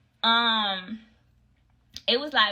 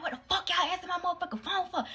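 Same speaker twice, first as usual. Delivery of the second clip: retold angry outburst; 8 semitones higher, 6 dB quieter, faster than usual. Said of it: "I was so mad".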